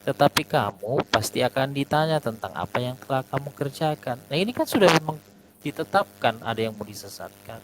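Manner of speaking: usual speaking voice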